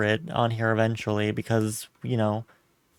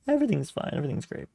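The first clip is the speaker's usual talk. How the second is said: sarcastic; 3 semitones higher, 8 dB quieter, much faster than usual. Pretend. After failing to catch Pokémon.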